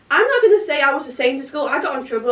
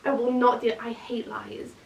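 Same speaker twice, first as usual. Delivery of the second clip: ordinary delivery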